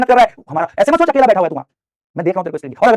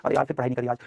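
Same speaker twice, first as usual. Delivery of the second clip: low, soft self-reproach; mutedly disappointed in oneself; feeling rotten; 5 semitones lower, 8 dB quieter than usual. Acted. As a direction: voice a student's regret, dropped and quiet. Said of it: "एक गंदा गंदा feel होता है".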